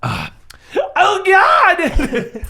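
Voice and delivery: sudden high, loud panicked cry; panicked oh God. Acted. Player voicing a character in trouble, high laughing panic.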